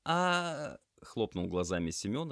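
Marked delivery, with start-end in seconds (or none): 0.1-0.8 high, blank "ah"; bewildered. A narrator's reading. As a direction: react lost and startled. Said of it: "растерянно смотря на салфетки".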